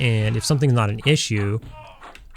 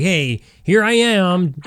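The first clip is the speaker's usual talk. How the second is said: slow, higher, louder singsong hello; mock cheery announcement; 7 semitones higher, 4 dB louder, much slower than usual. Imagined undocumented person announcing himself to police, mock cheery and slow. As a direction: wave yourself into view like a fool.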